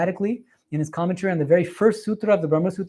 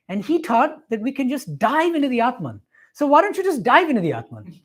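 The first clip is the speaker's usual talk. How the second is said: higher-pitched, emphatic imitation of a breezy adviser; smiling bakery lady; 5 semitones higher, 5 dB louder than usual. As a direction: play her with a knowing smile, pitch lifted. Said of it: "she gave me this smile, and she said".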